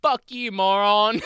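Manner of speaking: loud, high, drawn-out insult; mock outburst. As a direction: hurl it slowly as a defiant witness. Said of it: "It's just like".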